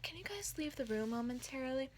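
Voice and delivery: a hushed whisper to the other students; whispered request. Retold or imitated. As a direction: whisper so the others leave quietly. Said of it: "she's like".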